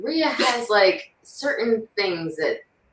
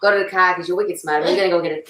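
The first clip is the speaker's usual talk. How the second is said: R-less Boston accent, open "ah" vowels; 2 semitones lower, faster than usual. Shown as a demonstration. Showing a friend the Boston sound, Boston accent. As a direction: talk like a Bostonian, dropping every R. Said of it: "a Boston thing".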